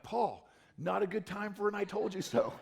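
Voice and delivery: wry aside. Retold or imitated. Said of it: "I read this verse and I went".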